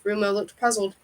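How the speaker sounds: ordinary delivery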